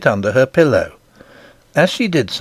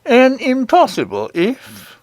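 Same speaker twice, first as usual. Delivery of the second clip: slow, stately king, pitched up; lofty, measured character voice; dignified; 12 semitones higher, slower than usual. A narrator's reading. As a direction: pronounce it slowly with royal dignity. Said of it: "said His Majesty with dignity".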